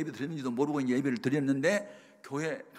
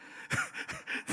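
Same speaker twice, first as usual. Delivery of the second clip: voiceless laugh to himself; chuckle; 4 dB quieter than usual. Real, not acted. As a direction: break off into a short laugh. Chuckles at his own story. (혼자 터뜨리는 짧은 웃음)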